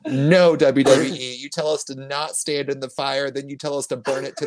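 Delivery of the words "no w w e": usual speaking voice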